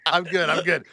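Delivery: tense laugh while insisting he's okay; forced laughing reassurance. Retold or imitated. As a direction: laugh it off, pretending all is fine. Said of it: "I go".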